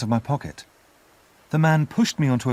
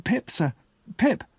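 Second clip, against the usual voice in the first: put-on a scared boy whispering, then high; frightened whisper; 11 semitones higher than usual. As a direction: breathe the name out in fear, then squeak it. Terrified boy answers the convict.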